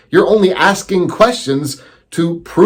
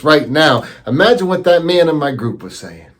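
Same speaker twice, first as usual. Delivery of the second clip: usual speaking voice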